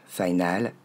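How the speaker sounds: FINAL as "fai-nal", open A at the end; "final" ending in a plain A; mistaken A ending. Shown as a demonstration. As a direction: mispronounce English "final" with a French "al". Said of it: "comme un A".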